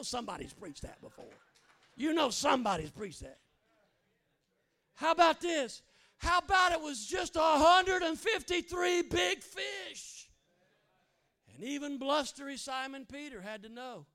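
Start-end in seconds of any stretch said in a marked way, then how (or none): 4.9-10.3 high, loud, slow preaching cry; preaching shout. Acted. Pastor shouting to the congregation, full-force preaching. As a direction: bring the point home at full force.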